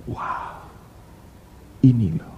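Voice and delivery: soft unvoiced whisper of awe; awed whisper. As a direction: breathe it in wonder. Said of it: "engkau bilang di hatimu". (berbisik kagum dalam hati)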